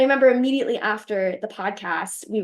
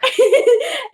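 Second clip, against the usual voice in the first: a sudden high, loud burst of laughter; 9 dB louder than usual. Real, not acted. Laughing at finding out her talk was already posted.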